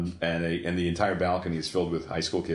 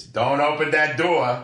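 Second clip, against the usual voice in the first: loud, high, drawn-out yell; imitated usher yelling; 9 semitones higher, 7 dB louder, slower than usual. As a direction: bellow it slowly, mimicking a fierce usher. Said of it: "who is yelling at the kids".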